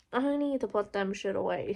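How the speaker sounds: ordinary talk, nothing marked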